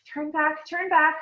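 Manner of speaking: urgent, rising hurry; quick urgent turn back. Acted. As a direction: hurry them away, alarmed. Hermione urging retreat.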